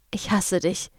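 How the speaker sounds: softly spoken, understated; quiet. A narrator's reading. Jess to the man standing very close, quietly. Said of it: "sagte Jess leise".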